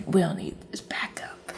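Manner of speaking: slow, hushed whisper; whispered quote. Retold or imitated. Character answering his puzzled date, in a slow whisper. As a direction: whisper it slowly, like a confidence.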